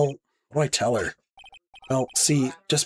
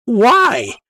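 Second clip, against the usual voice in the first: 1 loud demand; 10 semitones higher, 8 dB louder than usual. Acted. Pleading with refusing lawyer.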